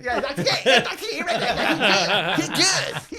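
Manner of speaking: put-on high, loud, laughing old-barber voice; old barber impression. Narrator riffing on the barber from Coming to America. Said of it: "the barber from Coming to America".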